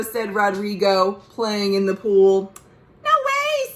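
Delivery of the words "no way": squeaky little brother saying no way; high kid voice. Acted. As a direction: voice a cheeky little boy. Jose's brush-off, voiced high.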